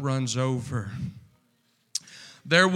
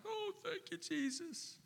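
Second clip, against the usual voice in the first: tearful thanks; 13 semitones higher, 17 dB quieter than usual. Retold or imitated. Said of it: "I'm, like, crying".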